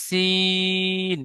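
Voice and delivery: সিন with a thin hissing s, drawn very long; thin long sin. Shown as a demonstration. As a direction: hiss সিন like a pressure cooker whistle. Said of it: "এটা পাতলা আওয়াজে pressure কুকারে সিটির মতো". (পাতলা আওয়াজে লম্বা টেনে সিন)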